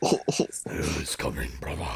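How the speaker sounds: very low, slow, gruff voice; deep gruff warrior. Retold or imitated. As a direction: growl it like a grim soldier. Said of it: "repeated in a put-on voice".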